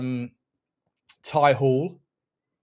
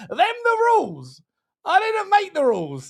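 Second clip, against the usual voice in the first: mock innocence; 11 semitones higher than usual. Streamer teasing rival fans, high mock-innocent shrug.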